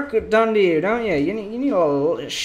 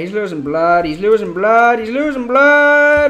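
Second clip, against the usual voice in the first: more alarmed each time; rising panic; 5 dB louder than usual. Real, not acted. Patient bleeding out in game.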